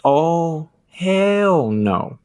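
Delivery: high, drawn-out mock nurse attitude; imitating the nurse's sass; sassy hell no. Dad retelling how the nurse scolded him, with attitude. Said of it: "she had an attitude".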